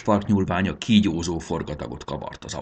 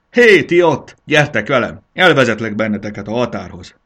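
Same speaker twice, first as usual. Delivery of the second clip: loud hail, high at first then easing; calling out; 4 semitones higher, 9 dB louder than usual. A narrator's reading. Botorka calls the group to follow her, loud hail. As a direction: holler to get their attention.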